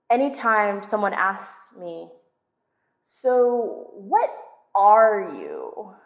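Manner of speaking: plain reading voice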